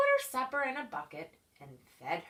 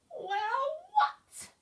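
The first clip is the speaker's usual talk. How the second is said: high, mock-innocent creature voice; 4 dB louder than usual. A narrator's reading. Creature pretends not to know, high and innocent. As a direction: play dumb, high.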